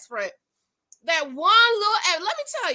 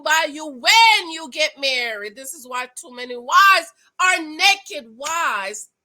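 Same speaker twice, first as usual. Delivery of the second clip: slow, emphatic sermon-like lecture; preaching; 3 semitones higher, 4 dB louder, slower than usual. Real, not acted. Vlogger lecturing women viewers about marriage, preaching.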